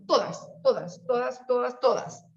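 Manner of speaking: "todas" repeated, each one stressed; emphatic repetition. Shown as a demonstration. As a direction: hammer the word "todas" home. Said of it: "teacher repeats for emphasis".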